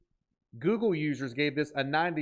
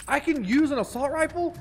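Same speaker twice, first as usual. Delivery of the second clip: surprised; 11 semitones higher, 4 dB louder than usual. Real, not acted. Finds rifle in game.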